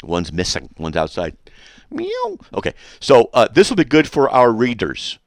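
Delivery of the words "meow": high squeaky cat meow; mock meow. Joking that the critic is missing paws, playful meow.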